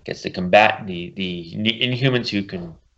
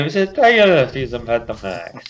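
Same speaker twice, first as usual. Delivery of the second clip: mock Italian accent; 3 semitones higher than usual. Joking back at co-host about the botched accent, mock Italian accent. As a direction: play along with a bad Italian accent. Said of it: "I figured it was, like, Italian or European".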